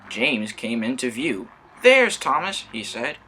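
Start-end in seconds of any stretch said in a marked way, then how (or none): 1.8-3.2 high, loud call across the line. Acted. James spotting stuck Thomas and calling out high and loud. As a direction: shout it out eagerly. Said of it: "whistled James".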